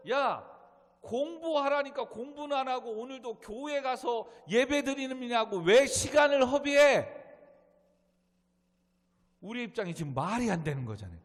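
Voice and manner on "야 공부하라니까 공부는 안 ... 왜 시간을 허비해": loud, high-pitched telling-off; mock tirade, building louder to the end; acted scolding parent. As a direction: scold a student like an exasperated parent. Parent scolding churchgoing student, acted.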